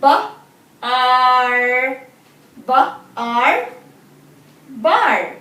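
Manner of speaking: Ba and Ar split, then blended into Bar; sounding out words. Shown as a demonstration. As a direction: sound out Ba, Ar, then join them. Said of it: "Say the sound slowly".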